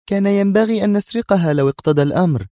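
high, heated exclamation; exasperated cry. A narrator's reading. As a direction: cry it out in annoyance. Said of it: "هتفت محنقة". (هتاف محنق)

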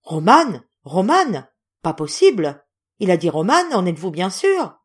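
Romane called out loud in disbelief; stunned shout. A narrator's reading. The general, hearing his friend's name, shouts in astonishment. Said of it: "Le général sautant de dessus son fauteuil".

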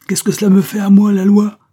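high, loud, quivering outburst; indignant cry. A narrator's reading. Angèle rebelling against the law, crying out indignantly. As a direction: cry out in defiance, pitch way up. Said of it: "s'écria Angèle, toute frémissante".

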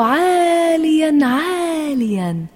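high, drawn-out storytelling words; long, lifted vowels; soaring upward. A narrator's reading. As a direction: stretch each word up into the air. Narrator paints the shuttlecock soaring.

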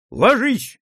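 sharp, high shouted warning; loud shout. A narrator's reading. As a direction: bark the order to hit the ground. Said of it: "громко крикнул".

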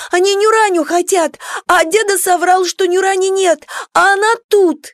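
put-on high panicked little boy; frightened child voice. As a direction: blurt it like a scared kid.

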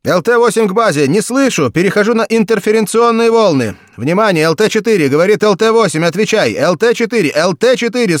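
loud, high urgent pilot radio; over a pilot headset. A narrator's reading. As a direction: keep calling the silent crew. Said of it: "слышался голос пилота".

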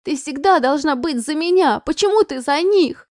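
high, loud tearful plea; sobbing. A narrator's reading. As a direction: cry out, voice rising. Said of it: "уже откровенно рыдала Аня".

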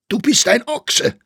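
high, loud, furious outburst; shouting an insult. A narrator's reading. Staufner insulted by Friedinger's teasing, shouting. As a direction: yell it in outrage. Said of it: "schrie Staufner".

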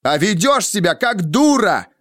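high-pitched heated retort, volume contained; angry yell. A narrator's reading. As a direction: hurl it back as a restrained yell. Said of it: "заорал я, срывая голос". (запальчиво, почти срываясь на крик)

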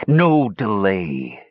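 slow, menacing villain; sinister hiss. A narrator's reading. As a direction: hiss it out slowly. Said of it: "his voice dropping to a sinister hiss".

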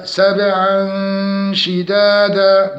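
سبعا شدادا with a bouncing b; chanted سبعا شدادا; qalqalah on ba. Shown as a demonstration. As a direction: echo the b in سبعا, then hum before شدادا. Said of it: "مع قلقلة الباء".

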